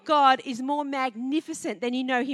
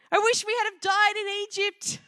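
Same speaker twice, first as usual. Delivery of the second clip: higher, hammed-up wail; mock wailing; 7 semitones higher than usual. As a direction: whine it like a moaning Israelite. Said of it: "They wailed".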